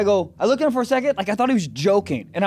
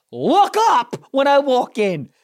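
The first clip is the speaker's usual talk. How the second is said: slow, loud, higher-pitched scolding of the clerks; indignant scolding; 6 semitones higher, 5 dB louder, slower than usual. Retold or imitated. Ranting at clerks who ignore him, slow indignant scold. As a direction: drag it out to lecture the lazy staff.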